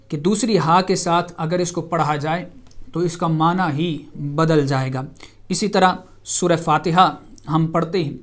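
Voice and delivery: ordinary talk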